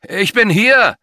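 loud, high-pitched shout; calling out. A narrator's reading. Talut calls to the unseen person, high loud shout. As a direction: call out so a distant person hears you. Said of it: "rief er".

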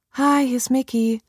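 a hint of airy, toneless greeting; toneless breath. A narrator's reading. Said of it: "hauchte ihre Tochter so tonlos".

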